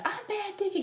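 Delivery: very high reenacted squeal; fangirl calling out. Fan in the spotlight answering Diggy, squealing. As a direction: squeal for the star's attention.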